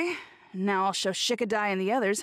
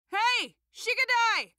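yelling out; 10 semitones higher than usual. Acted. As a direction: call out the name, stretching it to carry. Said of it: "Don't yell out my real name".

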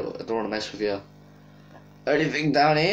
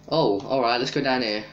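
normal talking voice

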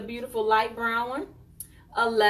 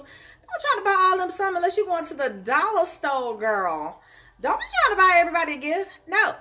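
higher and louder, fast telling-off; sassy scolding; 6 semitones higher, 5 dB louder than usual. Real, not acted. Host scolding viewers who overspend, louder sassy warning. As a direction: warn them off like a big sister.

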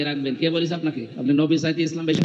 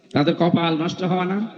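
normal talking voice

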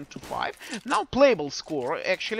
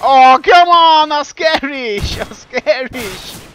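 loud, high, drawn-out exclamation; excited slow shout; 5 semitones higher, 13 dB louder, slower than usual. Real, not acted. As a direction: yell it out in disbelief. Caster reacting to play.

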